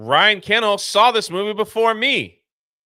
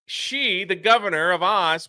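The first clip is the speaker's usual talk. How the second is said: ordinary talk, nothing marked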